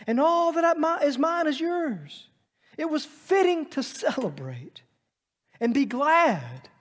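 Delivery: pleading father. Acted. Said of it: "And he said to him".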